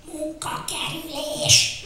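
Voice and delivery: ordinary delivery, nothing marked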